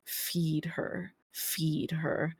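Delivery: soft, eerie chant from many mouths; hive voice muttering. Acted. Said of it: "hive voice just muttering".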